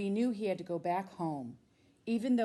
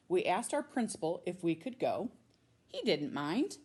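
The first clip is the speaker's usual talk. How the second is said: plain reading voice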